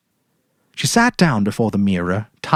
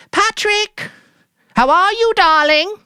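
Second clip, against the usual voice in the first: much higher, louder sing-song greeting; falsely cheerful wife; 4 dB louder than usual. Acted. Mary calling her husband as she comes in, falsely bright. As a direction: call out as if nothing's wrong. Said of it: "humming a little tune".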